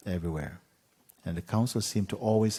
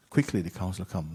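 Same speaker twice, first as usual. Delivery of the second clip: ordinary delivery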